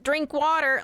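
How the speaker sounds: put-on high, loud valley-girl California voice; mock a strong California drawl; strong California accent. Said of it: "the very strong California".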